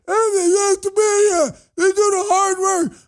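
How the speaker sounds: high, hammed-up whiny anchor; mocking a TV host melting down, high and slow; mock screaming anchor. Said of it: "sitting there screaming".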